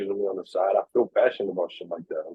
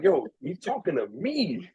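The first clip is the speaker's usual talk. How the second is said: slow, higher-pitched incredulous retelling; mock disbelief; 6 semitones higher, 3 dB quieter, slower than usual. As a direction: stretch it out, acting taken aback. Retold disbelief at being singled out.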